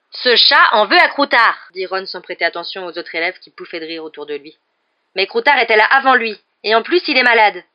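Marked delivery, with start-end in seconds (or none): 0.1-1.7 loud, slow, emphatic charge; raised, indignant voice; angry accusation. A narrator's reading. Ron accusing the cat in quarrel. 5.1-7.6 loud, raised protest; heated, higher-pitched outburst; furious. A narrator's reading.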